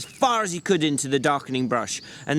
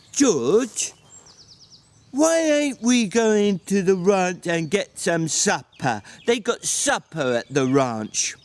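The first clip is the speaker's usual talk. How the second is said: slow, higher simple-minded big man; Lenny's slow childlike voice; 5 semitones higher, slower than usual. A narrator's reading. Lenny asking George about supper, slow and childlike. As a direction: plead like a slow, simple giant.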